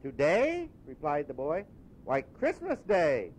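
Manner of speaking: put-on high, puzzled boy, slow on Christmas Day; a lad amazed anyone would ask; young boy. Boy telling Scrooge it's Christmas, puzzled. Boy's voice.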